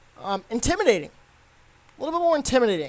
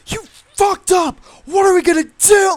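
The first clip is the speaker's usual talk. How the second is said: full-volume impression of an outburst; imitated yelling; 12 semitones higher, 10 dB louder than usual. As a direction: yell it out, imitating a film character losing it. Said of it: "Like, he's yelling".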